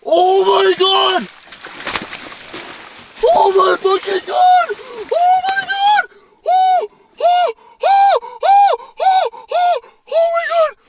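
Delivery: high, loud, elated shrieks; ecstatic screaming. Real, not acted. Angler hauling up a monster fish, ecstatic screaming. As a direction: scream it out in disbelief at the catch.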